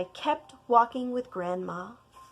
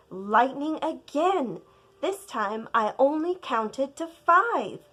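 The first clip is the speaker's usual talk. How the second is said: ordinary delivery, nothing marked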